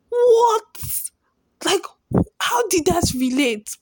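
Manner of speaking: high loud shocked what; baffled disbelief; surprised. Retold or imitated. Shocked reaction to mom's remark.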